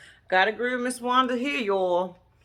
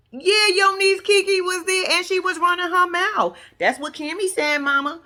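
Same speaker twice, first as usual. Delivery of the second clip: high, loud mock tattling; imitated tattling daughter; 9 semitones higher, 5 dB louder than usual. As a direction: act out a daughter insistently telling on someone. Acts out daughter telling on niece.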